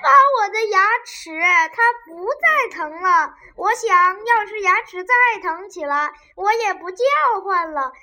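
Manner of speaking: 又急又快、大声求饶; 害怕拔牙，急着求姨妈; 慌张哀求. Acted. 汤姆求姨妈别拔牙. (怕拔牙的孩子慌忙大声哀求)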